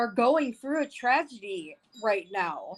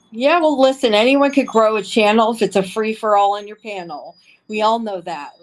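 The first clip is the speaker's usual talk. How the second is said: much louder, emphatic jab at rival channels; loud rant; 7 dB louder than usual. Real, not acted. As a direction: call them out hard. Angry rant at other channels.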